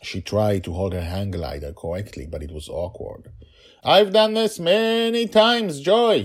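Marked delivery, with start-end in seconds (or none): 3.8-6.2 put-on child voice. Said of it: "she said".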